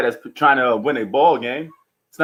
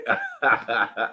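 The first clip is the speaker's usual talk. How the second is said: real chuckle after a comeback; amused laugh; 3 semitones lower, 7 dB quieter than usual. Real, not acted. Host laughs at co-host's age claim, amused laugh. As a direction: laugh at the other person's argument.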